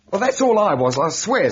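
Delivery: rising panic, defend yourself; agitated protest. Acted. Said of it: "Now, calm yourself".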